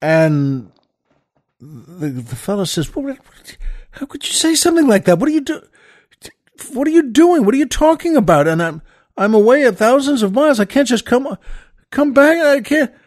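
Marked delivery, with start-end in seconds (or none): 2.9-12.9 high, upset disbelief, rising in pitch; a brother reeling from blunt news, high and upset; shocked outburst. Acted.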